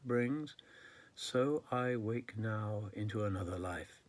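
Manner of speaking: measured, lifted poet's recital; poetry read aloud with deliberate phrasing; poem reading. Acted. Reads own poem aloud.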